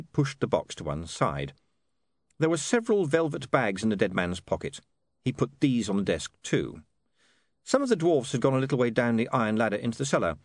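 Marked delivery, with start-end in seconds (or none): none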